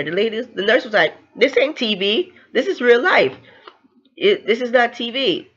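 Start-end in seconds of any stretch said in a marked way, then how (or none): 1.3-5.5 higher-pitched, insistent comeback; retold firm correction. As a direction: set her straight, pitch raised. Nurse snaps back at patient.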